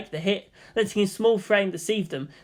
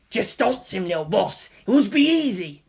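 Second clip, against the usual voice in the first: gleeful ork voice; 3 semitones higher than usual. A narrator's reading. Inner Ork voice urging.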